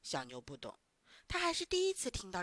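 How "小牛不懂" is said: usual speaking voice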